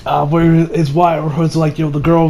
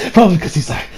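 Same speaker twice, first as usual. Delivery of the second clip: normal talking voice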